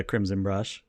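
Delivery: ordinary talk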